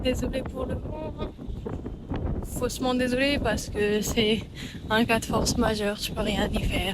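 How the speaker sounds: loud voice over the wind outside; shouting over wind. Real, not acted. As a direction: raise your voice above the wind. Outdoors, speaking over wind. (parler fort contre le vent)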